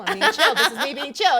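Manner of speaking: loud laughing insistence; hammed-up protest, anything but relaxed; failed chill. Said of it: "You're chill, still not being chill".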